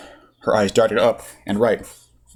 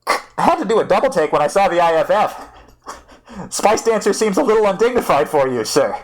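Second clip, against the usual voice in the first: cheeky pilot; 10 semitones higher than usual. Acted. Biko on comm.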